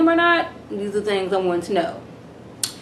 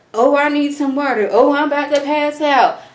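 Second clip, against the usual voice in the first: mock fainting fuss; 6 semitones higher, 4 dB louder than usual. Mocking Jackie's fainting fuss.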